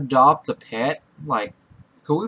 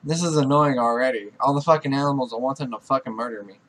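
normal talking voice

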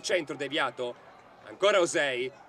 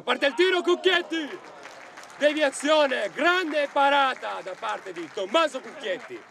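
excited save call; 10 semitones higher, slower than usual. Real, not acted. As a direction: shout the keeper's name in awe. Big save called in commentary. (telecronaca esaltata della parata)

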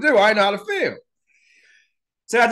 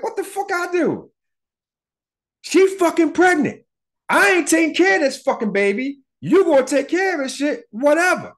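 voiced angry mom. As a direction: shout it high like the furious mother. Girl's mom yelling in story.

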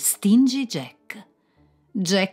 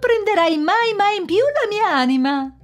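much higher, louder gleeful demand; triumphant Jack; 9 semitones higher, 6 dB louder than usual. Acted. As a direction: crow in victory over the devil. Jack trionfante detta le condizioni. (Jack trionfante, molto più acuto e forte)

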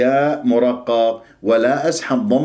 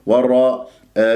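ordinary delivery

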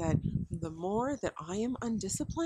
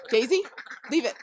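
much louder firm order to the dog; sharp loud command; 5 semitones higher, 9 dB louder, faster than usual. Real, not acted. Owner stops her dog going after the chickens, loud firm command. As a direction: bark the name, then drop it hard.

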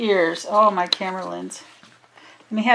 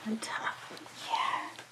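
whispering; 3 semitones lower, 16 dB quieter than usual. Retold or imitated. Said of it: "So I was just like".

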